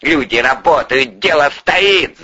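spiteful, rising mimicry; angry inner retort. A narrator's reading. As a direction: echo his words back sourly. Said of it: "зло подумал Порешайло".

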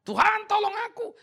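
high, loud cry for help; crying out to God. Retold or imitated. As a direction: call out to God in desperation. Desperate prayer in a crisis. (teriakan minta tolong kepada Tuhan)

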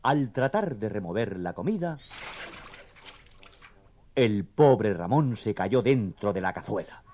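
ordinary delivery, nothing marked

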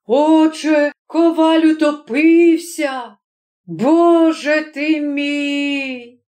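high, slow lament; acted dismay, very drawn out; shocked headman. A narrator's reading. Headman laments the smith's death.

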